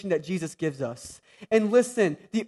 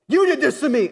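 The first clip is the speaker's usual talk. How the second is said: mock blame; 6 semitones higher, 5 dB louder than usual. Mocking a blaming parent again.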